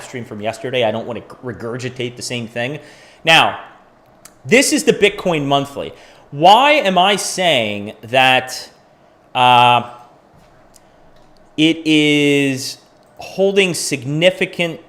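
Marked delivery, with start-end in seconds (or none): 6.3-14.8 slow, halting delivery with long pauses; stumbling over words. Real, not acted. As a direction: grope for the words, stretching them out. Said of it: "I'm stuttering over my words".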